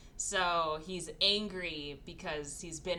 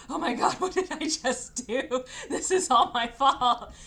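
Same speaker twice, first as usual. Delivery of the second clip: higher, louder, laughing through it; mock horrified panic; 6 semitones higher, 8 dB louder than usual. Sarah after knocking out her sister's teeth, laughing mock panic. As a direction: wail it in guilty panic. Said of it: "And Sarah's like".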